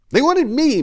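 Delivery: frantic, hands flying; exasperated. Acted. Maria explaining to Enrico, frantic and exasperated. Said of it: "beginning to gesticulate in exasperation".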